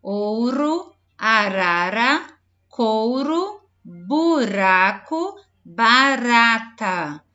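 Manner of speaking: slow, loud list with a soft tapped r; soft R demo. Shown as a demonstration. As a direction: stretch each word, tapping the r lightly. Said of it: "Olha o R brando".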